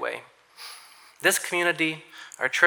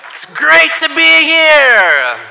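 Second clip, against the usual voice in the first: much higher, much louder and slow, hammed-up gushing; over-the-top enthusiasm; 15 dB louder, much slower than usual. Shown as a demonstration. Translating the Minnesotan greeting for outsiders, hammed-up gushing. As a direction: blast it out like an overjoyed host. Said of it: "now I will translate for the non-Minnesotans".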